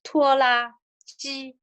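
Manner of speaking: tuola ji said in Mandarin tones; teacher saying the Mandarin for tractor; Mandarin word. Shown as a demonstration. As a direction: pronounce tuola ji as a Chinese word. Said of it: "a tractor".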